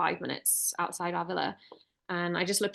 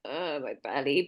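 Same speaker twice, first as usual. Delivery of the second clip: lower, slow groaning sick voice; 6 semitones lower, 3 dB quieter, slower than usual. Retold or imitated. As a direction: moan it like your stomach hurts. Imitating sick partner groaning.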